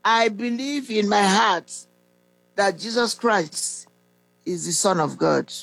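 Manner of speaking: slow, measured prayer recital with long pauses. Real, not acted. Preacher leading listeners to repeat a salvation prayer after him, slowly. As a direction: lead the prayer phrase by phrase, drawn out. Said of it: "I'm going to say it slowly".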